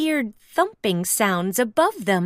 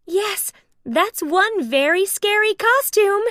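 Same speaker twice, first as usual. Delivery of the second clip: Daisy voice; 9 semitones higher, 3 dB louder than usual. Acted. Daisy speaks.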